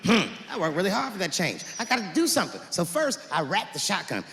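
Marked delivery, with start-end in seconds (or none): none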